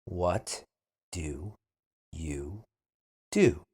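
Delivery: What do you do? split into single words. Shown as a demonstration. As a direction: say What do you do? one word at a time. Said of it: "then repeat slowly".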